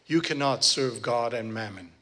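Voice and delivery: usual speaking voice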